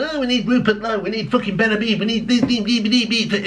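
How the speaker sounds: high mock whining voter; mocking whine. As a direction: whine it like a fickle moaner. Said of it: "And you'll all be going".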